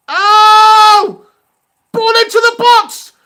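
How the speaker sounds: high, loud excited yell; excited shout. Real, not acted. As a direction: shout it out as the chance comes. Chance in the match.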